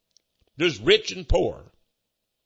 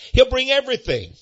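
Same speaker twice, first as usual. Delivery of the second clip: higher, laughing through it; 9 semitones higher than usual. Real, not acted. Preacher joking about supper, laughing. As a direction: chuckle through everything. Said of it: "laughing while preaching".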